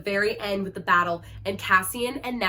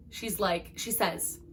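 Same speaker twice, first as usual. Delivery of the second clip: ordinary talk, nothing marked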